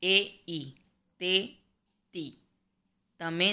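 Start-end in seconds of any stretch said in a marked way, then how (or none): 0.5-0.7 E turned into ઈ; dialect vowel shift. Shown as a demonstration. Teacher showing students the Sorathi vowel, ઈ said as "ee". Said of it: "એનો ઉચ્ચાર ઈ". 2.1-2.4 તી with "ee" for "e"; dialect vowel shift. Shown as a demonstration. Teacher showing students the Sorathi form, તી with an "ee". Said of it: "એનો ઉચ્ચાર ઈ".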